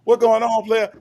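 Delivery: high, playful call-in voice; lightly voiced caller greeting; mock caller greeting. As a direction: imitate the prison-call opener. Mimicking the awaited call greeting.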